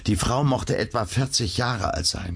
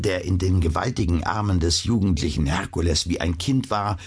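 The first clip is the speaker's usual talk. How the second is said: ordinary delivery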